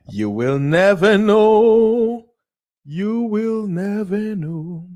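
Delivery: high, slow drawn-out warning; slow high mock-ominous. Dramatic repeat of catchphrase.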